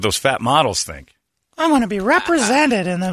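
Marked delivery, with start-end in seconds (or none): 1.6-3.1 mocking high voice. Mocking fat models.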